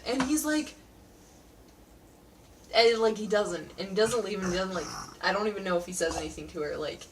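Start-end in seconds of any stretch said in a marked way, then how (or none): none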